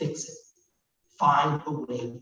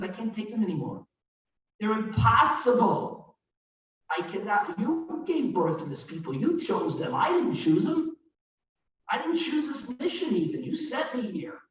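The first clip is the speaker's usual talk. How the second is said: exasperated Moses; 6 semitones higher than usual. Acted. Moses complaining to God, exasperated.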